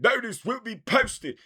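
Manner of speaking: high, loud theatrical caricature; mimicking an English actor. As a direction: squawk it out like an over-the-top Englishman. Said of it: "who the fuck the one dude".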